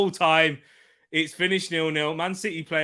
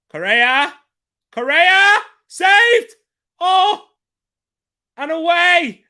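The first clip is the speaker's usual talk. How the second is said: each name higher and louder until a full yell at the save; building excited shouts; 14 semitones higher, 11 dB louder, much slower than usual. Real, not acted. Fan shouting at Correa's chance and the save, building to a yell. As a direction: ride the chance up to a roar. Goalmouth scramble, live reaction.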